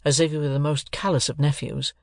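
plain reading voice

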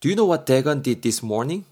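very slow, careful English sentence; slow example read. Shown as a demonstration. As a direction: read it slowly for students. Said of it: "A가 뭐라 그러고 있어요". (천천히 예문 읽기)

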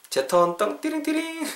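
high sing-song ding-a-ling; vocal chime jingle. Pretend. Teacher announcing his turn with a playful sung jingle. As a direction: imitate a little chime, pitched right up.

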